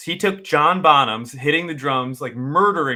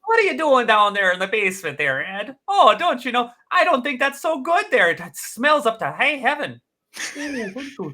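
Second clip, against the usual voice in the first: higher, Midwestern folksy voice; Fargo accent; 10 semitones higher than usual. Acted. Questioning Ed about the basement, higher Fargo accent. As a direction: play the nosy neighbour, folksy.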